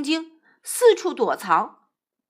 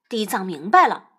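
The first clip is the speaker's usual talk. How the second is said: plain reading voice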